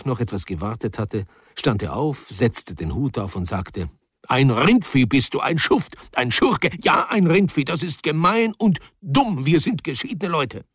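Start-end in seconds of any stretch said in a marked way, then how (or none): 4.3-10.7 raised, louder voice hurling insults; furious outburst at his rival; angry insult. Acted. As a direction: let the contempt boil over.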